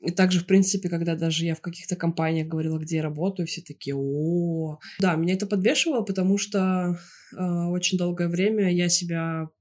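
ordinary delivery

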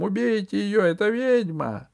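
high, loud accusing yell; shouting. A narrator's reading. Usurper to his guards, high shouted accusation. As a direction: scream it, pointing her out. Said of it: "закричал он телохранителям".

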